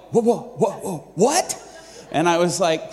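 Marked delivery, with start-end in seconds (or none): 0.1-1.6 imitating startled disbelief, slightly raised; stunned. Companions reacting to waitress saying yes, stunned. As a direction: stammer out a mock astonished double-take.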